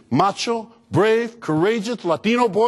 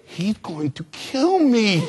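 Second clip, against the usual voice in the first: slow, higher-pitched comic wail; mock panic; 4 semitones higher, much slower than usual. Son pleading with vice principal, slow panicked wail. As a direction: draw out the dread like a doomed kid. Kid panicking before dad.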